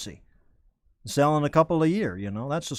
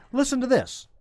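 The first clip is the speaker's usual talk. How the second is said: "Listen to this" pitched way up, then falling; corrected inflection; 10 semitones higher than usual. Shown as a demonstration. Host correcting his tone before the news, high-pitched "Listen to this". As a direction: say "Listen to this" in a high, lilting announcement. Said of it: "I should say".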